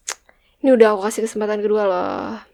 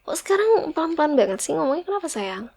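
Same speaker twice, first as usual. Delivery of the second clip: whisper; 5 semitones higher than usual. Acted. Whispered teasing complaint.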